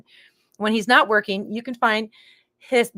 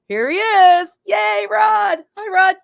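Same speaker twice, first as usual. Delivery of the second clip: high, loud cheer calling the guest in; excited welcome; 13 semitones higher, 8 dB louder, slower than usual. Real, not acted. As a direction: cheer him onto the stage, big and high. Host welcomes guest onstage.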